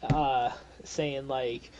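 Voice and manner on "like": usual speaking voice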